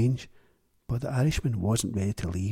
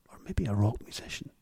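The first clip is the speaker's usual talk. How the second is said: plain reading voice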